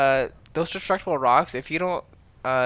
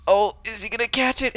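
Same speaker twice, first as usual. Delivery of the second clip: excited; 8 semitones higher, 5 dB louder than usual. Real, not acted. Caster watching a chase.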